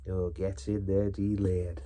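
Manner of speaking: lightly put-on catchphrase voice; Seaside Mark. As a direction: do a quick YouTuber catchphrase impression. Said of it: "When will the Seaside Mark references stop".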